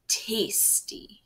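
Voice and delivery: whispered, relishing wolf; wolf savoring. Acted. Wolf patting his belly, whispered relish. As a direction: breathe it like a satisfied wolf. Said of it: "he said, patting his belly".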